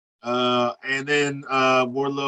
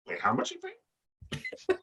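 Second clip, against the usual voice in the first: mock squeaky voice; 10 dB quieter, much slower than usual. Mocking voice, laughing at clip.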